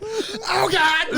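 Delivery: high-pitched comic yelp; mock agonized cry. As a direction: imitate the overacted, irreverent wail. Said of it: "you don't think of, like".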